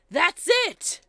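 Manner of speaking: sudden loud eureka cry; excited shout. Acted. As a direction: blurt it out, a breakthrough. Sudden realisation in courtroom.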